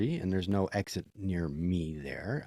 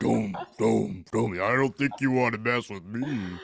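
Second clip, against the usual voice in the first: mock heavy deep giant; deep stomping threat; extremely deep bass voice; slower than usual. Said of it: "you're walking heavy now".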